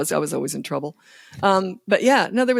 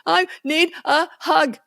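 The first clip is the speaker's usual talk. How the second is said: put-on higher, slow, pleading child; whiny child; 9 semitones higher, slower than usual. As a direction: wheedle like a toddler. Child voiced after tantrum.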